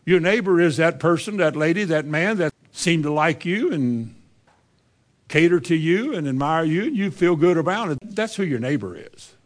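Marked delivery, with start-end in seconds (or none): none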